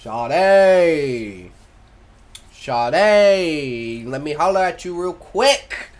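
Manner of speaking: loud call across the train car; loud friendly shout. Acted. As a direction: holler at her from far off. Character calling out across train.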